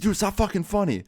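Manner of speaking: high, whiny imitation of a friend; mock indignant protest. Trapped friend objecting to being laughed at, high mock indignation.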